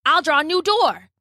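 high-pitched eureka line; acted excitement at an idea; sudden bright idea. A narrator's reading. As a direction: announce it as your own brainwave, pitch up. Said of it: "as though he had just had a great idea".

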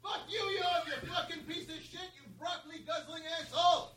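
1 heard from a distance. Acted. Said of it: "We'll hear it from the distance".

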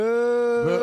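boo like a heckler; playful boo. Pretend. Booing again in jest.

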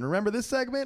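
usual speaking voice

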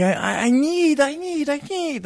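high, stammering German rocker begging; desperate pleading. Retold or imitated. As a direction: stammer out the need, pitched high. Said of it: "waving his hands in the air, and he's going".

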